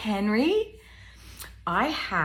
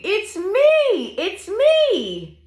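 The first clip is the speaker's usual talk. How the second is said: high, sing-song reveal with stretched ME; gleeful high animal voice for kids; excited pig; 10 semitones higher, 8 dB louder, slower than usual. Acted. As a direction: squeal it proudly as the pig.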